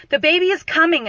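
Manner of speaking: high, loud cry of alarm; retold scream. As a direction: imitate a labouring mother calling out. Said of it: "she starts screaming".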